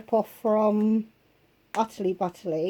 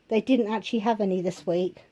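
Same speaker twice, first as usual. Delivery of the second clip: ordinary talk, nothing marked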